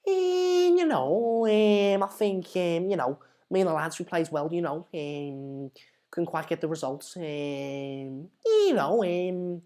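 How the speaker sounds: hesitant Scouse with very long fillers; Gerrard-Rooney mix. Acted. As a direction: mumble a soft Scouse post-match interview. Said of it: "a mix between Gerrard and Rooney".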